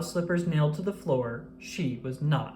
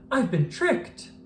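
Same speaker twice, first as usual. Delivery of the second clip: shocked cry; 7 semitones higher, slower than usual. A narrator's reading. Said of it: "cried George".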